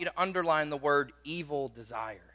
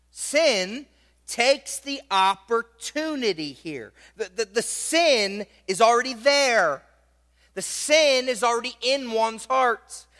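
raised, insistent pulpit voice; fervent preaching; 4 semitones higher, 5 dB louder than usual. Acted. Pastor pressing the congregation on sin in the heart, fervent preaching. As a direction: drive each point home from the pulpit. Preaching a sermon at full force.

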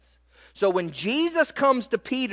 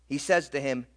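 normal talking voice

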